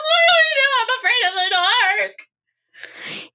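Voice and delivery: very high, squeaky fearful voice; frightened reindeer. Acted. Rudolph afraid of the dark, high frightened squeal. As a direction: squeal it like a scared little creature, fading at the end.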